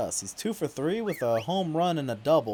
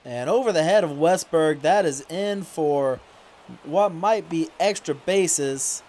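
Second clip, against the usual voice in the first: excited play-by-play; 4 semitones higher, 4 dB louder than usual. Acted. Gamer calling his own baseball game, excited play-by-play. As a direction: call the hit like a live broadcaster.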